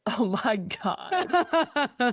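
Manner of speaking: a slow, stretched-out "Oh my God" in a lightly voiced husband; drawn-out husband groan. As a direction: play the husband groaning, dragging each word out. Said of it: "And Logan was like".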